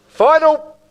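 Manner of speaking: high, loud auction call; calling final. Real, not acted. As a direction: call it out across the room.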